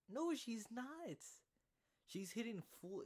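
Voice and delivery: long, very high, drawn-out No; indignant denial. Real, not acted. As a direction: stretch the No out slowly in disbelief. Rebutting commentators' claim.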